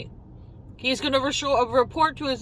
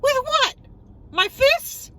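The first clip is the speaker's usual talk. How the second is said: high, louder disbelief; incredulous outburst; 11 semitones higher, 3 dB louder than usual. Retold or imitated. Answering the bumper accusation, high incredulous. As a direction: fire back in mock outrage.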